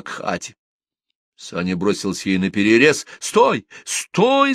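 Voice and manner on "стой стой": high urgent yell, second one higher; shouting. A narrator's reading. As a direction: shout to halt a driver. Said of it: "закричал Малешкин". (кричит вдогонку, высоко и резко)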